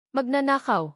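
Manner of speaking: high, venomous shout; a screamed insult full of hatred. A narrator's reading. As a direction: hurl the accusation across the room. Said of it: "Ang sigaw ni Isabelle".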